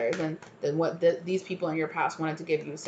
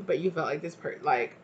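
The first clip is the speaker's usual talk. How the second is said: ordinary talk, nothing marked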